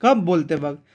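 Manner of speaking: ordinary delivery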